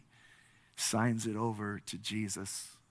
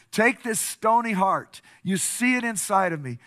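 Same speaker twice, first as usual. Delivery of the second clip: raised, emphatic altar-call preaching; fervent preaching; 5 semitones higher, 5 dB louder than usual. Real, not acted. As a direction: drive the appeal home to the congregation, punching key words. Preaches climactic appeal and prayer.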